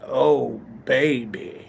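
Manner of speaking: low, mocking ugly woman's voice; a sultry voice put on badly. A man mocking a lover's ugly bedroom voice. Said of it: "a woman with an ugly voice".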